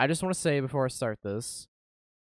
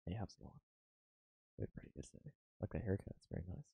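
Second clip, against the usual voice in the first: hushed and close to the mic; soft voice; 5 semitones lower, 14 dB quieter, faster than usual. Acted. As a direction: speak softly, intimate.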